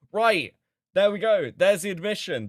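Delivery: you caught him out, crow it; triumphant gotcha. Real, not acted. Streamer catching the admission, triumphant and loud.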